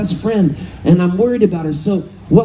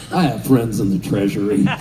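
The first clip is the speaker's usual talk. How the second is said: much lower, deadpan mock voice; knowing low mutter of a tech legend; deadpan Woz; 13 semitones lower than usual. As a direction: drop the voice low and dry as Woz. Wozniak's deadpan reply retold.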